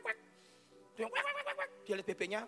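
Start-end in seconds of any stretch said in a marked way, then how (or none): 1.1-1.7 high, soft rapid quacks; squeaky little duck noises; imitated duck quacking. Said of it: "papanya ambil bebek-bebek".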